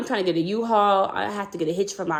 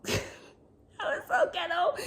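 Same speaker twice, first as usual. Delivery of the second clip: laughing through it, much higher; 11 semitones higher, 7 dB quieter than usual. Real, not acted. Telling viewers about the messy move, laughing. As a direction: crack up mid-sentence.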